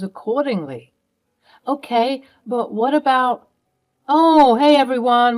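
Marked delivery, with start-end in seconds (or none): 4.1-5.4 sudden bright, higher, louder hello to cover up; fake cheerful greeting. Tom, caught plotting, greets the family with fake cheer. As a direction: greet them too sweetly, hiding the scheme.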